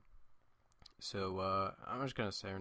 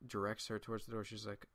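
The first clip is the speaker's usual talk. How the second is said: usual speaking voice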